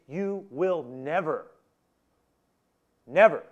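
"You will never" slow and weighty, each word separate; strong. Acted. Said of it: "It's strong".

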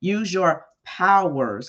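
mock him like a cruel guard; sarcastic taunting. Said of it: "'cause they were being sarcastic".

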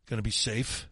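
ordinary talk, nothing marked

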